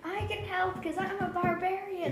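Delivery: high-pitched naive barbarian; high, innocent character voice; eager interruption. Acted. Player interrupts the speech.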